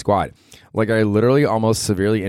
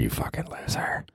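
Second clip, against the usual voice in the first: hushed, breathy put-down; whispered insult; 8 semitones lower, 11 dB quieter than usual. Retold or imitated.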